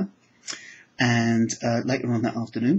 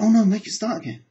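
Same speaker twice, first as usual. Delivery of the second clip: louder, higher protest; retold alarmed plea; 9 semitones higher, 8 dB louder than usual. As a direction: imitate a student suddenly pleading in dismay. Student's retold alarm at the silence.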